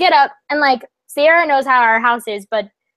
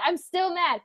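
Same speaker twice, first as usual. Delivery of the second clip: usual speaking voice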